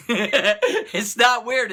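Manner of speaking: slow, high, with a laugh on it; laughing through the words. Real, not acted. Speaker amused by his own point, slow laughing line. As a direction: laugh as you say it, drawn out.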